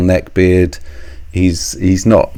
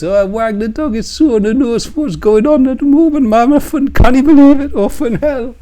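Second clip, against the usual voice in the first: mocking Geordie impression, much higher and louder; hammed-up Geordie, pitched way up and gushing; bad Geordie accent; 5 dB louder than usual. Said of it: "really bad version of your Geordie accent".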